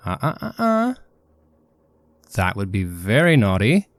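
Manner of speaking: put-on wag a finger at a naughty child; playful warning. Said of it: "saying something to the effect of".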